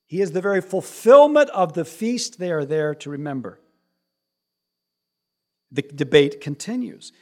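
ordinary delivery

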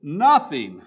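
loud, forceful pulpit declaration; preaching emphasis. Real, not acted.

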